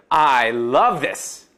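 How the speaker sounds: very slow, drawn-out "I love this."; overdone gush. Shown as a demonstration. Teacher mimics foreigners overdoing a liking, gushing. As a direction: oversell how much you love it. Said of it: "오버를 하는 것처럼 들리는 거죠".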